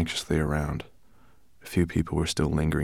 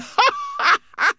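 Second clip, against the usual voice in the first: loud, high gloating laugh; high mocking laugh; 6 dB louder than usual. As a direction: laugh him down. Rosenberg laughs at Greenbloom.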